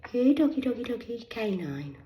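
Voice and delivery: high, loud calling to a dog; retold shout. Shouting at the man like calling a dog.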